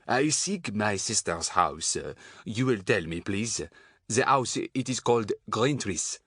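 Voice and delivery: put-on slow, halting French accent. A narrator's reading. Bundled-up man asking a woman for directions, French accent. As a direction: ask politely in careful French-accented English. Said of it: "suddenly going very French".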